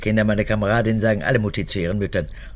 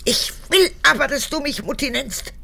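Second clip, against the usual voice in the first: much higher, petulant demand; whiny insistence; 14 semitones higher than usual. Acted. Old mother pleads with her daughter to be called Mutti, high and whiny. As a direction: insist like a sulking child.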